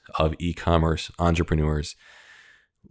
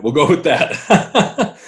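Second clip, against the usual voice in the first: laughing through the words, higher; 10 semitones higher, 6 dB louder, much slower than usual. Real, not acted. Host laughing along with Kevin's breathless joke. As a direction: laugh it off, amused.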